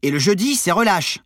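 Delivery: high, loud comeback; indignant retort. A narrator's reading. Crewmate rebutting monitor's threat, indignant. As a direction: correct the monitor cheekily. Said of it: "répliqua Armand".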